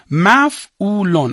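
slow, drawn-out dictation; dictation pace. Shown as a demonstration. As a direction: say it slowly for students to write. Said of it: "علامتاش رو زیرش بنویس".

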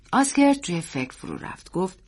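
plain reading voice

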